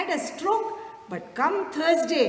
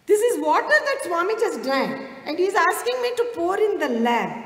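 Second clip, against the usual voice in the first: higher and louder, marvelling at it; amazed; 7 semitones higher, 6 dB louder than usual. Retold or imitated. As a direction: tell it still astonished. Amazed at Swami's request.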